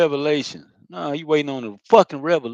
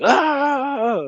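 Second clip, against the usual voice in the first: a much higher, louder overwhelmed ah; 12 semitones higher, 5 dB louder than usual. Retold or imitated. Near breaking point during his process, a high strained ah. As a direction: blurt out a strained ah. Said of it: "I feel like".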